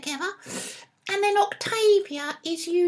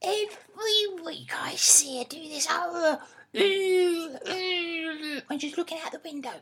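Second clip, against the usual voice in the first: imitating Mum; 3 semitones lower, 4 dB quieter than usual. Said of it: "this is what Mum does".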